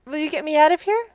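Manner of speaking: high little creature voice; pleading flying monkey. Acted. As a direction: beg to be let out as a little monkey.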